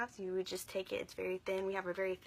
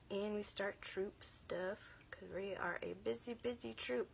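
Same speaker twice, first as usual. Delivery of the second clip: straining to talk; 8 dB quieter than usual. Real, not acted. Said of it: "I'm sorry if I seem like I'm straining to talk".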